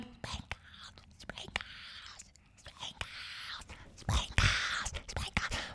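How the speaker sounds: whispered calls from very far away; barely audible shouting. Retold or imitated. Teachers heard from up in the clouds, barely audible whisper. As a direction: whisper it like a shout lost in distance. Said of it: "I couldn't hear them. It was more like".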